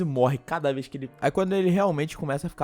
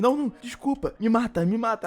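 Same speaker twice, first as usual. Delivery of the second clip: mock pleading boy; 8 semitones higher than usual. Boy begging after making her cry.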